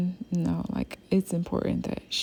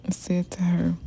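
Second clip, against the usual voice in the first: usual speaking voice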